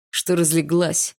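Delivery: slow, gruff bark. Acted. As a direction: snap at her to get up. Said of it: "рявкнул Барс".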